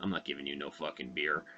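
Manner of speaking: put-on low, soft grumble of a cranky old shopkeeper; a lightly voiced cranky store owner; gruff old man. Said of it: "the guy's like".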